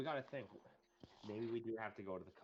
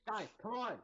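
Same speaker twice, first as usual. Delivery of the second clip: raised, higher call to the group; loud impatient call; 9 semitones higher, 5 dB louder than usual. Acted. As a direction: call them over, impatient.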